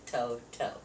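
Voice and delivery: ordinary delivery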